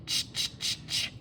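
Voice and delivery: unvoiced ch, ch; isolated ch sounds. Shown as a demonstration. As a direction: hiss out the ch sound softly. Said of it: "Let's spell chick first".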